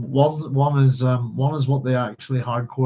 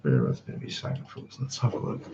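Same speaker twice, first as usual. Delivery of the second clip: whispered mutter while searching, lower; whispered aside; 10 semitones lower, 9 dB quieter than usual. Real, not acted. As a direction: breathe it to yourself, barely voiced.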